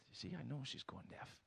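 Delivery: muttered very softly to himself; husband muttering about his deaf wife; mumbled under breath. Retold or imitated. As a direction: grumble it under your breath. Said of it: "he mumbles under his breath".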